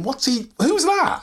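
high, slow incredulous onlooker; drawn-out, high-pitched puzzled query; mocking disbelief. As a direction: imitate a baffled onlooker, pitched right up. Imagined crew mocking his acting.

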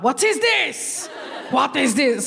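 puzzled audience member, drawn out; baffled audience. Retold or imitated. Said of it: "They're like".

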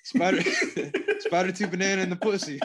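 laughing. Real, not acted. Laughing while repeating crude joke.

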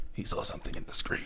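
breathy mysterious whisper; spooky whisper. Pretend. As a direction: whisper it like a ghost story. Dramatic whispered delivery.

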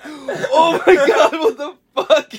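high, laughing disbelief; laughing shock. Retold or imitated. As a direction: shriek through laughter. Friend falls off balcony.

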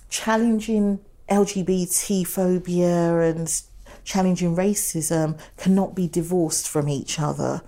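ordinary talk

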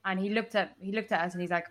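usual speaking voice

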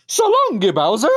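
very high cartoon Italian catchphrase; Mario. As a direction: squeal it high, rising at the end. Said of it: "what he's really saying is".

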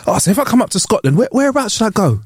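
high-pitched eager asking, retold; mock-eager high voice; eager question. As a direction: pitch it up, keen and curious. Retells own question to agent.

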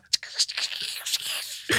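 whispered crackling noise of crusty bread; baguette crack sound. Retold or imitated. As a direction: imitate a baguette crunching. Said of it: "and it goes".

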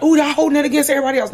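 high, fast sing-song mockery; mock-scandalised high squeal. Mocking people's reaction.

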